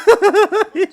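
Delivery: loud, high burst of laughter; loud, high real laughter. Real, not acted. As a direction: crack up hard. Laughing at own story.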